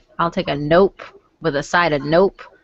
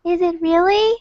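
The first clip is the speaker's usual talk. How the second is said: higher, coy innocent question; cute; 9 semitones higher than usual. Retold or imitated. Answering a compliment on his voice, cute and coy. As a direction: play it sweet and coy. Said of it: "I cutely just go".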